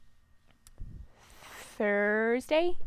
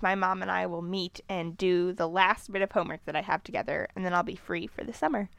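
ordinary talk

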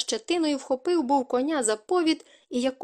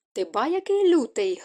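lightly mocking jeer, pitched up; 4 semitones higher, 3 dB louder than usual. A narrator's reading. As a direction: toss it off with a touch of mockery. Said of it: "глузливо кинула коротунка". (глузливо, з легкою насмішкою)

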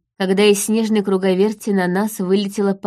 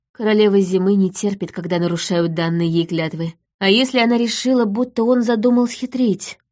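plain reading voice

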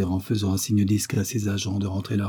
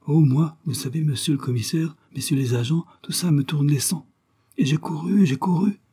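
lightly acted agitated woman, higher pitch; flustered, puffing caretaker; 6 semitones higher than usual. A narrator's reading. Caretaker's wife to the commissioner after running, flustered. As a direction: fret in a raised voice after running. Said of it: "en se tenant le sein gauche et en soufflant".

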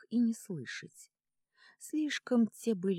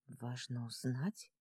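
quiet disbelief; 4 semitones lower, 8 dB quieter, slower than usual. Acted. Darina echoes the words, stunned.

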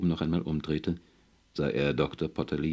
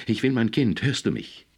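laut und hoch fordernd; wütend ihr Recht verlangen; schreiend; 7 semitones higher, 5 dB louder than usual. A narrator's reading. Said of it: "Sie warf sich schreiend auf ihn". (außer sich gerufen)

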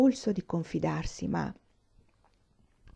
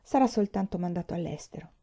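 ordinary delivery, nothing marked

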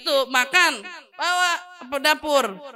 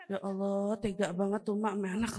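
pitying onlooker; 5 semitones lower, 10 dB quieter than usual. Retold or imitated. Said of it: "Itu kalau kita ngelihat".

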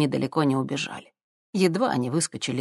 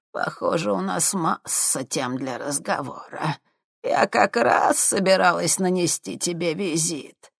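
sinister crone; slower than usual. Acted. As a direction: purr it like a sinister old witch. Sinister old woman speaks.

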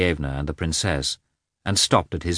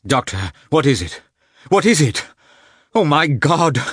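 loud, high frantic pleading; desperate cry; 9 semitones higher, 6 dB louder than usual. A narrator's reading. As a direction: cry out to the doctor in rising panic. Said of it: "he cried".

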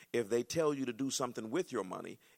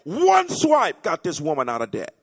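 much louder, slow, high declaration; slow loud pulpit climax; preaching shout; 8 semitones higher, 18 dB louder than usual. Real, not acted. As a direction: hammer the point home. Preacher hitting his point.

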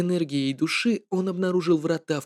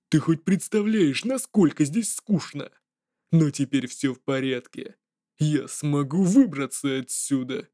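sly jester voice. Acted. Jester spirit speaks to Wang Dong.

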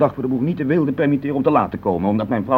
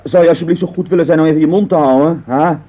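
louder, higher, fed up; irritated outburst; 5 semitones higher, 5 dB louder than usual. Acted. Husband snaps at his wife over breakfast, exasperated. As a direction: cut her off sharply, exasperated.

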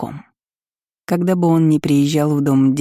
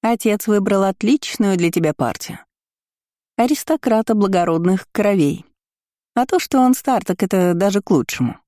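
higher, sneering sing-song; mocking cousin; 4 semitones higher than usual. Kiora taunts the heroine about the old groom, higher and sneering. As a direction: rub the news in sweetly.

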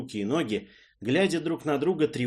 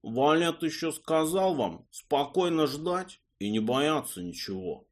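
slow, nasal, drawn-out whine; nasal drawl; 6 semitones higher than usual. Acted. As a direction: drag the words out through the nose. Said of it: "гнусавым голосом протянул Васенька".

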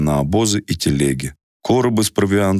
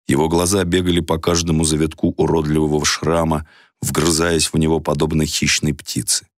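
plain reading voice

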